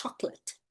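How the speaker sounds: soft two-syllable "chocolate", CHOC-let; English pronunciation. Shown as a demonstration. Teacher repeating the English word, soft two-syllable "chocolate". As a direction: say "chocolate" softly with stress on CHOC. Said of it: "em inglês ela diminuiu pra duas sílabas".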